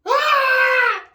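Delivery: high loud cry of discomfort; pained yell. Real, not acted. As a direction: yell out at the sting. Water going up nose.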